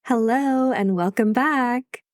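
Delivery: ordinary delivery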